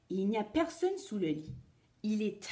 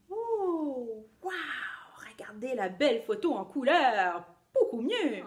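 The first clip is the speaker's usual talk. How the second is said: put-on cheerful children's show host; kids party magician; 3 semitones higher than usual. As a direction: play the magician for little kids.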